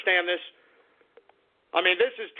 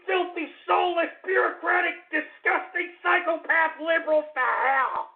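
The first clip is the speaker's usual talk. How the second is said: furious ranting; 8 semitones higher than usual. Real, not acted. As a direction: pour out the rage, pitched way up. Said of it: "can you feel the anger".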